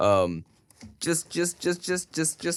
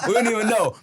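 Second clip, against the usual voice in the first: laughing; 8 semitones higher than usual. Retold or imitated. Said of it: "and we'd be like".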